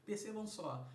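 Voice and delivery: ordinary talk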